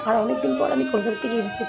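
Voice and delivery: usual speaking voice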